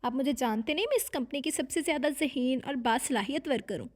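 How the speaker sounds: usual speaking voice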